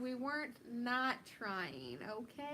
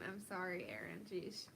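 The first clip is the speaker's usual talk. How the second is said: calmed down apology; 5 semitones lower, 7 dB quieter than usual. Real, not acted. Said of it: "let me calm it down".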